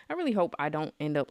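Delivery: ordinary delivery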